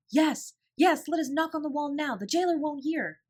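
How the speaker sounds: high, loud excited yes; eager agreement. A narrator's reading. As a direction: jump at the idea. Ermengarde eagerly joins game.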